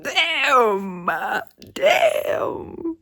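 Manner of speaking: long, wailed exclamations of disbelief; drawn-out shock. Real, not acted. Reader reacting to a bad plot turn, drawn-out shock. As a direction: stretch each word out, rising to a cry.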